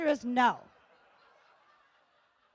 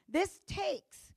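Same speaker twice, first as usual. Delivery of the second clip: usual speaking voice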